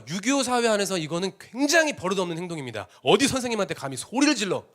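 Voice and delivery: usual speaking voice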